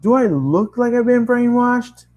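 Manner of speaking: high, loud indignant yell; screaming back. Acted. Narrator screams back at the scientist, indignant. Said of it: "I scream back".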